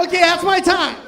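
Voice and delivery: high, loud call over the crowd; shouted comic announcement; mock sign-off. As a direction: belt it out as an abrupt exit line. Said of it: "It's like".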